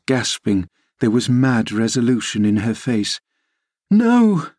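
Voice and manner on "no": very high, desperate cry; shriek. A narrator's reading. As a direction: shriek your refusal, pitch leaping up. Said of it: "she shrieked".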